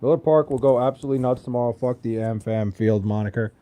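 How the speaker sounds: ordinary talk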